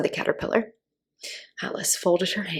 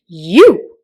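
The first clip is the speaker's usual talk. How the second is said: a sharp contemptuous "You!"; 14 dB louder than usual. A narrator's reading. Caterpillar sneering at Alice, contemptuous. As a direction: spit the word out with scorn. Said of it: "said the caterpillar contemptuously".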